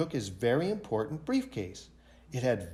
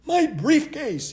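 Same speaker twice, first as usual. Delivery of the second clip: much higher, louder and slow dismay; alarmed businessman; 12 semitones higher, 5 dB louder, slower than usual. A narrator's reading. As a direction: discover the loss with a cry. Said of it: "he said".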